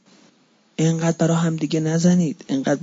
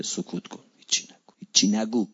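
whispered hush; 7 semitones lower, 7 dB quieter than usual. Shown as a demonstration. Preacher shows the congregation how to keep silent, whispered. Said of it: "امام رضا فرمود تو سکوت کن".

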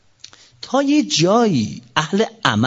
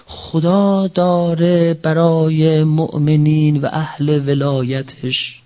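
slow fervent preaching; much slower than usual. Acted. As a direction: stretch every word with fervour.

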